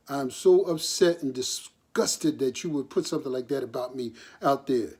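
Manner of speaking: usual speaking voice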